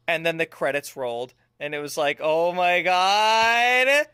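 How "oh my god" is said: loud, drawn-out swelling exclamation; hammed-up awe. Gamer retells the film's ending, mock awe. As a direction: stretch it out, rising all the way up. Acting out awed reaction to ending.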